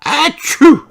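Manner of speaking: big hammy sneeze; cartoon sneeze; loud sneeze. Pedro sneezes.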